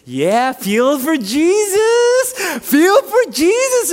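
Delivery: high, long-stretched ballfield holler; Mark Anderson. Retold or imitated. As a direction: imitate a gleeful yell, dragging the words out. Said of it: "he kept saying that".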